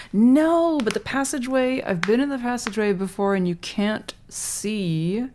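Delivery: loud, high self-correction; sudden emphatic. Real, not acted. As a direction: burst out correcting yourself.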